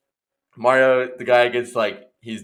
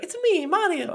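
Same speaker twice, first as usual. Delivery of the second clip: very high squeaky catchphrase; high-pitched cartoon plumber voice; Mario audition. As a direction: pipe it up in a tiny Mario falsetto. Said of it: "he came in and he's like".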